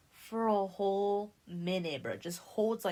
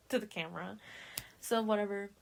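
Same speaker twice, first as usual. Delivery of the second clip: normal talking voice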